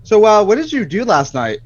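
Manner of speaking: suspicious question. Acted. Husband probing his wife, pointedly suspicious. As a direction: fish for the truth, too keen.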